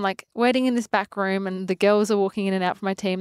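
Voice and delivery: ordinary talk, nothing marked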